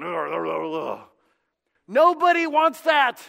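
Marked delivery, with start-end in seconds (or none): 0.0-1.1 imitating a bored churchgoer's grudging groans; mock groans. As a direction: groan like you'd rather be anywhere else. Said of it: "I'm sitting there going".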